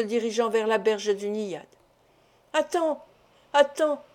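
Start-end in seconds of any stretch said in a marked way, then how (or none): 2.5-4.0 higher-pitched, mildly pitiful plea; piteous cry. A narrator's reading. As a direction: call after someone pleadingly. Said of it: "s'écria piteusement le docteur".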